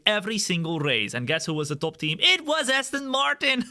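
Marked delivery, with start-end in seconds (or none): 2.2-3.7 laughing. Real, not acted. Joke about Aston Martin.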